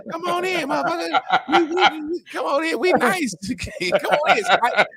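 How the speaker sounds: squeaky friendly puppy welcoming burglars in. Pretend. Mocking useless puppies to a friend, high laughing puppy voice. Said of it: "They, they like".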